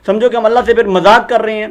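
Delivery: ordinary delivery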